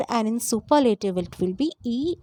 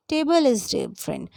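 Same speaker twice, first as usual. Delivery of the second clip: ordinary delivery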